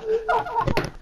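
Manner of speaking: high, soft burst of giggles; laughter. Real, not acted. Player laughing at his teammate's mishap, laughing. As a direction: laugh in a quick high-pitched run. Laughs at friend's failed flight.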